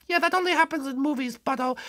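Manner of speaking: high, soft little bunny voice; squeaky dismissive bunny. Acted. Bunny rejecting the different newcomer, softly squeaky.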